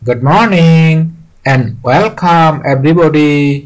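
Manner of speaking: stretched classroom greeting; teacher greeting class. Acted. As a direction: read the teacher's welcome in long sing-out syllables. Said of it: "এটা teacher বলছে".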